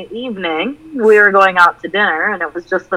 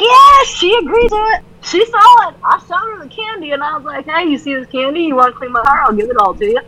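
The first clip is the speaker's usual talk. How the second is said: bragging son; 9 semitones higher than usual. Retold or imitated. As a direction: brag about the deal. Son bragging, voiced high.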